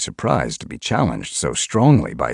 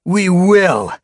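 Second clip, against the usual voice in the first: much higher, louder cheer; eager shout; 10 dB louder than usual. A narrator's reading. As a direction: answer with fervour. Warriors yowl agreement.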